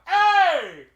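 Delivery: very loud, high, drawn-out yell; hailing shout. Retold or imitated. Storyteller calling a cab on the street, huge shout. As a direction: bellow it down the whole street.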